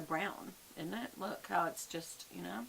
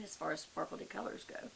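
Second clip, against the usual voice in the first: ordinary delivery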